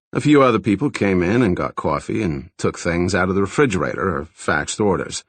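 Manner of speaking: ordinary delivery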